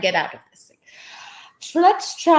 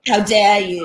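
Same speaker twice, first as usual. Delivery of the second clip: Greta impression; 3 dB louder than usual. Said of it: "that was a line from our favorite, from Greta".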